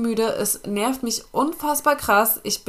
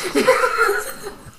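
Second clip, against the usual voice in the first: breathless laugh; 3 dB louder than usual. Real, not acted. Laughing after breathless remark.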